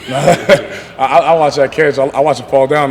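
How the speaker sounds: loud, laughing recollection; amused recollection. Real, not acted. Player recalls teammate's catch to reporters, laughing and loud.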